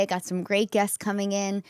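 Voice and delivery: ordinary delivery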